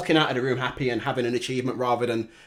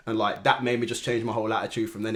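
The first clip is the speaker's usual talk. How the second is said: usual speaking voice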